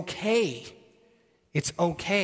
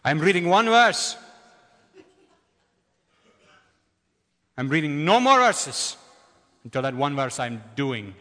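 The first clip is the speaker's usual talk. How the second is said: lightly voiced deep booming friend, louder; Saji's booming voice; 4 dB louder than usual. Pastor quoting friend Saji to the congregation, in a lightly booming voice. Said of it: "deep booming voice".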